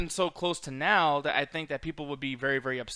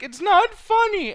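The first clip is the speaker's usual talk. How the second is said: high, loud, drawn-out imitation of tearful protest; mock crying; 9 dB louder, much slower than usual. Retelling her crying at being laughed at, mock wail. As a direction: wail it slowly, imitating someone in tears. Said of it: "she just starts crying".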